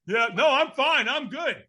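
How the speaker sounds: high, over-insistent "I'm fine"; false reassurance. Retold or imitated. Lying to a stranger that he is okay, false reassurance.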